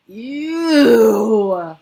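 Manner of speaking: loud disgusted roar. A narrator's reading. Bloodbottler tastes the Snozcumber, loud disgusted roar. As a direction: roar in revulsion. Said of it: "roared the blo".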